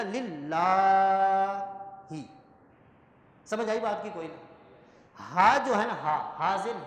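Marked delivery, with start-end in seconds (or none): 0.0-1.6 للہ with a long la, slowed; standing fatha held; long lillah. Shown as a demonstration. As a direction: stretch the alif in للہ. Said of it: "کھڑی زبر تو ہوگا".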